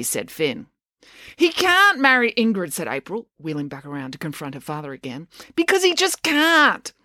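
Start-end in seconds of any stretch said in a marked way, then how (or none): 1.4-2.7 high, slow, emphatic objection; indignant protest. A narrator's reading. April confronts her father. 5.5-6.9 high, drawn-out insistence; stubborn indignation. A narrator's reading. April confronts her father.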